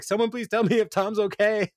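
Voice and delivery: very high-pitched mock-panicked plea; imitated rising panic. Podcaster as Andy begging for news of Tom, mock panic. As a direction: plead in a high, hammed-up panic. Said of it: "Andy is getting more and more panic".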